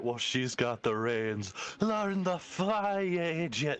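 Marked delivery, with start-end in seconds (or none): 1.8-3.8 scolding daughter. Retold or imitated. Bruenor's daughter scolding him.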